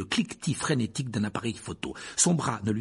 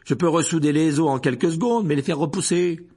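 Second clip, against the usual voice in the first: loud, fast, higher scolding; fuming; 6 semitones higher, 8 dB louder than usual. A narrator's reading. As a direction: scold him hotly. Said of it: "fulmina-t-elle".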